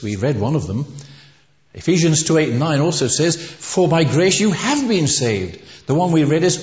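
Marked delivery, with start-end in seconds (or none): 3.4-5.6 "have" raised high and drawn out; stress on HAVE. Shown as a demonstration. Preacher quoting Ephesians on salvation's tenses, stressing "have". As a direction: punch "have" slowly to mark the completed tense.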